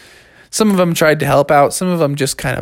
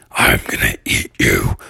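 very deep, spooky threat; menacing cave monster. Acted. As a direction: growl it low to scare kids.

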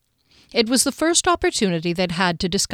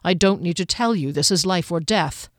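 plain reading voice

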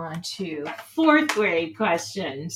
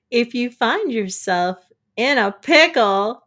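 ordinary delivery

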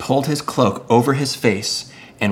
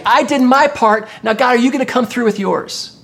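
challenging God; 8 semitones higher than usual. Acted. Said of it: "Elijah was calling God into question".

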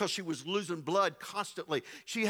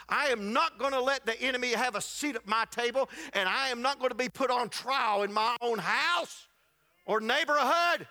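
preaching at full force; 7 semitones higher than usual. Real, not acted. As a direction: preach it with rising fire.

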